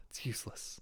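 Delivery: breathy whispered resignation; whispered defeat. Real, not acted. To himself after failing the pun, a defeated whisper. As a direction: let it die in a whisper.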